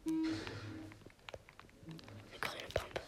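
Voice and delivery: very slow, drawn-out whisper; real hushed longing. Real, not acted. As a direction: breathe it out in awe. Spotting loot stealthily.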